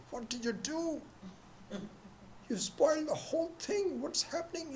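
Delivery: high, exasperated complaint; a director fuming over the ruined scene; angry director. Retold or imitated. Director scolds the mother after the play stops, high and exasperated.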